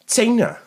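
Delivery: China said high with a ts start, like tsena; Welsh spelling sound. Shown as a demonstration. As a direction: pitch China up and open it with ts. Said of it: "China starts with a T and an S".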